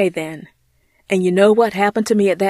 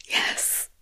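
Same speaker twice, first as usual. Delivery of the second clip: soft whispered yes; 9 dB quieter than usual. Acted. Courtney affirming her gratitude, whispered. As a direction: breathe the yes out. Grateful prayer aside.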